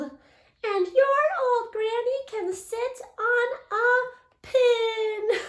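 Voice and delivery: high, loud squealing insult; pig yelling. Retold or imitated. Third pig yelling an insult at the wolf in a high, loud voice. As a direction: yell the insult like a little pig. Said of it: "the third little pig yelled".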